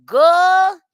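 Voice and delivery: sharp loud high exclamation; exclaiming good. Real, not acted. Host gloats over the rapper's money troubles, loud and high. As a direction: bark out a gleeful good. Cheering [name]'s crisis.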